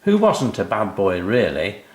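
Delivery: usual speaking voice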